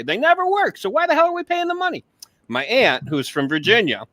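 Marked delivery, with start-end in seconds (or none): 0.0-2.0 high-pitched, incredulous griping; ranting a-hole. Acted. Acting out his old complaint about teachers' holidays, ranting. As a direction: swoop up high in exaggerated indignation. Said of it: "I was that a-hole that said".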